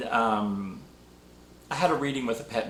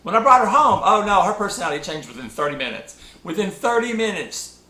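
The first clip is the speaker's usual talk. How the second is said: high, animated storytelling about the wild puppy; excited telling; 8 semitones higher, 6 dB louder than usual. Retold or imitated. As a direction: tell it with a big lift of delight. Amused about puppy's change.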